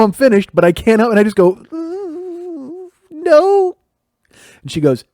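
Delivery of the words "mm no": high, strained hum into a squeaked no; squeaky, bottled-up denial; holding back laughter. Retold or imitated. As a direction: imitate stifling a laugh while answering. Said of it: "I'm trying not to laugh".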